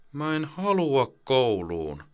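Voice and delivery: put-on high, slow child's mutter. Boy mutters against his mother's shirt, sulky and slow. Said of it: "Rasmus mutisee äidin paitaa vasten".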